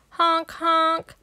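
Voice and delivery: high honking horn sound; truck horn. Acted. Recycling truck arrives at the playground, high honk.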